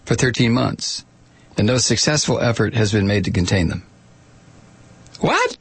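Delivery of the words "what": high-pitched, put-on "what?"; mock disbelief. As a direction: squeak it out in pretend surprise. Mock disbelief at failed containment.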